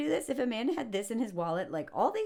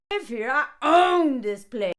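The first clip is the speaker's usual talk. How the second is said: slow, louder indignant claim; mock indignant; 2 semitones higher, 8 dB louder than usual. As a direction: assert ownership proudly. Indignant character.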